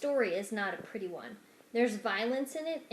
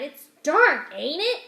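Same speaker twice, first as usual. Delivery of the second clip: loud, higher, booming question; 5 semitones higher, 12 dB louder, slower than usual. Acted. Mig booming at the rat on secret stairs, loud.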